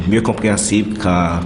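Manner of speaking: ordinary talk, nothing marked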